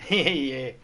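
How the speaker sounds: usual speaking voice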